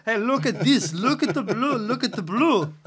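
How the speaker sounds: loud, high Russian-accent impression; Alex Ovechkin. Co-host mimics hockey star showing off his bruise, loud high Russian accent. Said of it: "That's how he talks".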